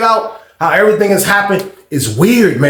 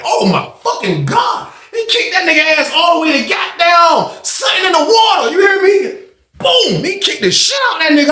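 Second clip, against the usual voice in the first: shocked excitement; 10 semitones higher than usual. Real, not acted. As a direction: react like you can't believe it. Reacting to brutal kick.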